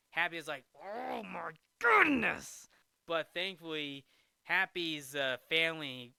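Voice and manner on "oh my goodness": slow, rising mock yell; exasperated outburst. Golfer reacting to the heckler's taunt, exasperated yell. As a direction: stretch it out into a loud high cry.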